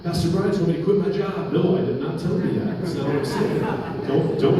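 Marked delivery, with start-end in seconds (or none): 0.0-1.5 lightly voiced church member, pitched up and quick to report; mock misquoting congregant. Pastor imagines a member blaming him, mock reporting voice. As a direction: play a member misreporting the pastor, higher.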